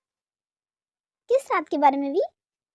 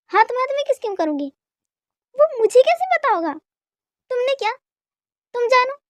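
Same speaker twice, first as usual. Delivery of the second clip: high, raised-voice argument; heated quarrel; 7 semitones higher, 4 dB louder than usual. Acted. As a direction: throw it back indignantly, pitched high. Heated quarrel over the kiss.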